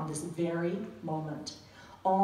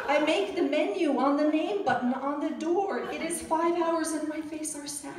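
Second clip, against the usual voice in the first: a mock foreigner pleading in broken sentences, higher and louder; earnest phrasebook tourist; 8 semitones higher, 5 dB louder than usual. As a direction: plead with the police using stilted textbook phrases. Reciting phrasebook Italian to police.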